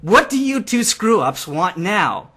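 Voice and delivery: slow and loud, trying to intimidate; intimidating. A narrator's reading. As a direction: lean in and try to scare them. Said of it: "my most intimidating tone".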